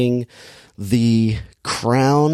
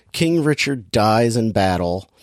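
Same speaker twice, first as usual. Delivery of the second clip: ordinary delivery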